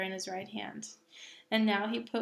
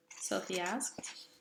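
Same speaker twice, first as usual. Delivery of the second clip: usual speaking voice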